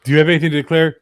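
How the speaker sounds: loud, posh customs officer. Retold or imitated. Movie quote, customs officer.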